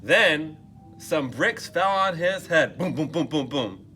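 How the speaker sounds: plain reading voice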